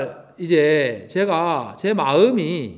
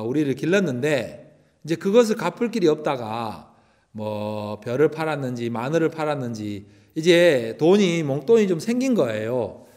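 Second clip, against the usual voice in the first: ordinary talk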